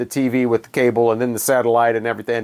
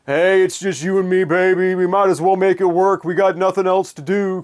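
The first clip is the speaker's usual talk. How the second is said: mock crooning [name] persona, a bit higher; [name] love song voice; 4 semitones higher than usual. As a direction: ham up a heartland rocker sweet-talking his baby. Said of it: "A lot of the typical ... kind of love song".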